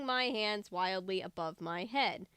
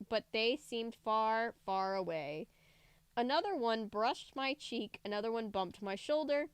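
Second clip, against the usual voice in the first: plain reading voice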